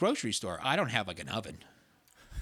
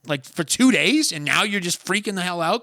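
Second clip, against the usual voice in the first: mocking scold; 9 semitones higher, 11 dB louder than usual. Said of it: "I'm like".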